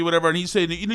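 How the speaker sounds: ordinary delivery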